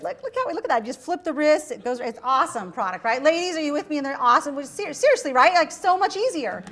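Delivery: loud, high-pitched eager pitch; so excited. Retold or imitated. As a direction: sell the gadget with giddy enthusiasm. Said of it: "I was so excited".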